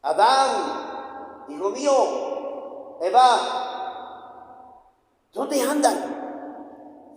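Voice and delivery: llamado muy lento y alargado, algo alto; Dios llamando lento. Acted. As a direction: estira cada palabra como quien busca a lo lejos. (Dios llama de nuevo a sus hijos escondidos, muy despacio)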